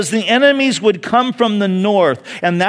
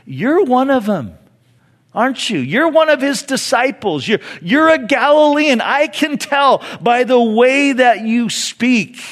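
higher-pitched, pointed accusation of Peter; a servant girl catching Peter out, voiced higher; servant girl accusing; 6 semitones higher than usual. Servant girl to Peter by the fire, higher accusing voice. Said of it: "she hears Peter talking and she says".

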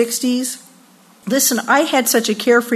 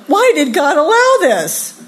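higher-pitched, protesting wail; indignant wail; 6 semitones higher than usual. Acted. Sufferer wondering why God let it happen, indignant wail. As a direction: cry out in mock dismay.

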